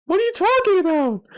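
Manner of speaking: a sudden very high, startled cry; shocked squeal. Real, not acted. Reacting to the girl coming back, high shocked squeal. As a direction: shriek it in disbelief.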